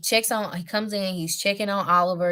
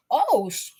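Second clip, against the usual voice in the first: surprised; 6 semitones higher than usual. Retold or imitated. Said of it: "King Kane shows up and goes".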